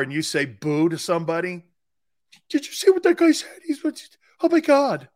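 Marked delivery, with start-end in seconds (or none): 2.5-5.1 high, loud mock gasp of outrage; shocked bystander. Mimicking shoppers shocked by a boo, high loud mock outrage. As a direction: play a scandalized shopper. Imitates shocked store people.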